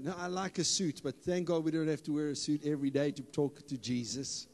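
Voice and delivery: ordinary talk, nothing marked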